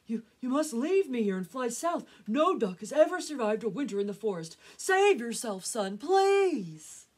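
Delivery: desperate plea. Acted. Papa duck begging his son to save himself, desperate and loud. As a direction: implore at full voice, building to please.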